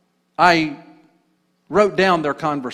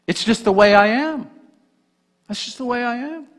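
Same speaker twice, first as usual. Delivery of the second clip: whiny excuse; 10 semitones higher, 4 dB louder than usual. Retold or imitated. Mocking a common excuse.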